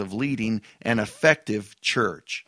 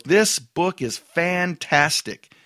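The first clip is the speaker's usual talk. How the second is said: slow, higher, emphatic "This book is fantastic"; slow and raised, every word stressed; read with emphasis; 5 semitones higher, much slower than usual. Retold or imitated. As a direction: read it like it ends in an exclamation point. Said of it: "I read that with emphasis".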